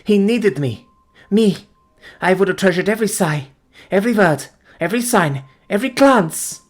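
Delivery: high, loud, rising lament; passionate grief. Acted. Fiancée confessing her devotion, high passionate lament.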